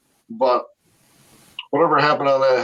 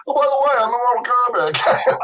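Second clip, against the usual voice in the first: lightly voiced breezy self-introduction, much higher; a hint of a faux-casual reveal, pitched up; mock casual announcement; 9 semitones higher than usual.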